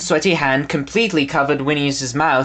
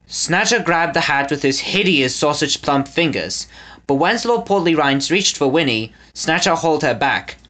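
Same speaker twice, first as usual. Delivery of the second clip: usual speaking voice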